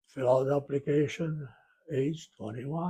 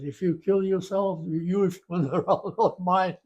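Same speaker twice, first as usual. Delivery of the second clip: put-on higher, louder, lightly French-accented warning; imitated French accent; 5 semitones higher, 8 dB louder than usual. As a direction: mimic the French examiner's accent with a laugh. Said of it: "his French accent".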